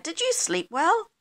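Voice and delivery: high, lilting wake-up voice; cheery question. A narrator's reading. Missus Bear asks her husband after waking, cheery and high.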